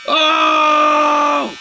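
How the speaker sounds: sudden fright; alarmed cry. Acted. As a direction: gasp out as you fall. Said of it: "cried Fish".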